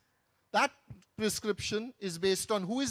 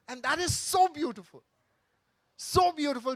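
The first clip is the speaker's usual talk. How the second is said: emphatic awe; 5 semitones higher, 5 dB louder than usual. Real, not acted. Moved by the story.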